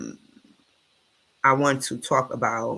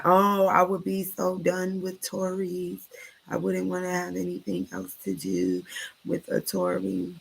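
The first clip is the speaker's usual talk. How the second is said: mocking quote; 5 semitones higher, 3 dB quieter than usual. Mocking people online.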